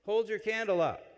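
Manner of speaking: Father calling out to the congregation; priest's call. Retold or imitated. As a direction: call it out to everyone in the pews. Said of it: "Father turns to everyone and says".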